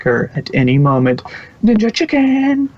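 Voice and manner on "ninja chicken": alarmed shout. Acted. Paranoid Trixie imagines attack.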